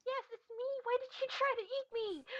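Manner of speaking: very high, squeaky indignant mouse; indignant squeak. Acted. Mouse confronting the cat that tried to eat it, indignant squeak. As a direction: squeak it out like an affronted little mouse. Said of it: "a very indignant squeak from Mouse".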